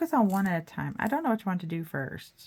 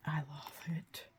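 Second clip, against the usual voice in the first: whispering; 3 semitones lower, 11 dB quieter, slower than usual. Real, not acted. Whispered delight at painting.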